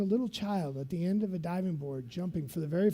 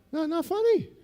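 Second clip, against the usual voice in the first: nervous reply; 10 semitones higher, 4 dB louder than usual. Retold or imitated. Scared jumper answers.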